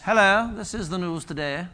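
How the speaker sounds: a gentle nod to a BBC radio announcer; mock BBC announcer. Preacher recalling old BBC radio news, hinting a BBC announcer accent. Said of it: "quintessential B B C radio voice".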